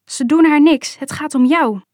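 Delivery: mother's urgent, insistent call. A narrator's reading. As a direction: cry out to hold him back. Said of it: "riep mijn moeder".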